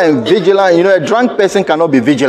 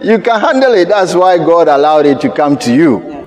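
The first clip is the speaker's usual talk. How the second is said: high, urgent preacher's cry; preaching; 6 semitones higher than usual. Acted. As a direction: preach it out with rising fire. Preacher at full force.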